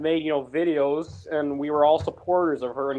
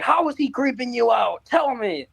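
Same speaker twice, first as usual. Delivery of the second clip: pushy fan; 8 semitones higher than usual. Retold or imitated. Pushy fan retold in story.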